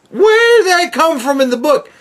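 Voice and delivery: indignant question. Real, not acted. Ranting about movie changes.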